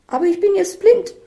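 very high, loud wail; hysterical protest. Acted. Isabel protests she is blind now, shrill loud wail. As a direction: overdramatise it at the top of the voice. Isabel overdramatises her broken glasses.

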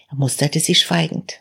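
ordinary delivery

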